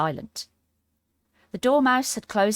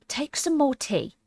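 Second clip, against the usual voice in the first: earnest; 8 semitones higher, slower than usual. Acted. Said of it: "very earnestly".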